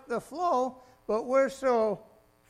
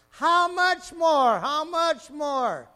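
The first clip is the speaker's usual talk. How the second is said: slow, drawn-out shouted how much more; preaching shout; 5 semitones higher, 11 dB louder, much slower than usual. Real, not acted. Preacher booming God's promise to the congregation, slow shout. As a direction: thunder it out slowly, much higher and louder. Preacher repeats with building force.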